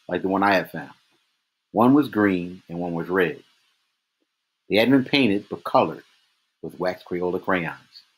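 ordinary delivery, nothing marked

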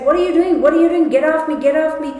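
high frantic cries of fear, loud; panicked struggle. Retold or imitated. Victim struggling against her attacker, panicked shouting. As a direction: fight him off, pleading in terror. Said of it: "she was still struggling".

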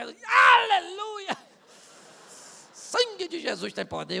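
sudden high, loud cry of pain; pained shout of aleluia; shouted hallelujah. Pretend. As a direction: yelp it out after stubbing a toe. Preacher kicks stone, shouts.

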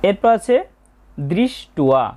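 ordinary talk

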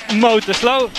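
ordinary talk

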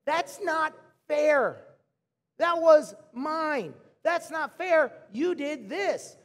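put-on whiny kids. Imitating his kids fighting over a toy, whining. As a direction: drag out a kid's whine. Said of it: "here's what I always hear".

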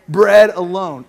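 bread alone called out loud, falling hard on bread; rally the room to shout it back; loud call to repeat. Shown as a demonstration. Preacher calling the congregation to say bread alone loudly. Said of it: "Say it, bread".

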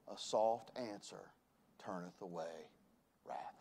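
scripture spoken softly; softly quoted scripture. Shown as a demonstration. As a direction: let soft fall quietly.

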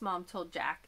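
usual speaking voice

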